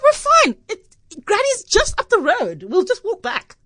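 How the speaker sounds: put-on a small child sure it's nothing; carefree little girl. Seven-year-old self reassuring sister.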